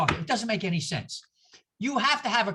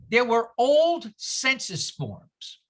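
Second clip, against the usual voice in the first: slow emphasis; much slower than usual. Shown as a demonstration.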